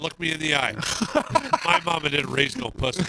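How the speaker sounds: loud, playful tough-guy declaration; mock tough boast. Angler boasting to friends after his hook story, mock tough. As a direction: boast loudly for laughs.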